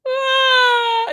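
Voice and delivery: high, loud mock wail of a crying kid; mock child cry. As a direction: cry out like a small hurt child.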